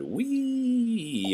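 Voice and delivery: high, delighted squeal of joy; a fire engine squealing with joy; gleeful whee. Acted. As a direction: let out a thrilled high whee. Engine thrilled while pumping.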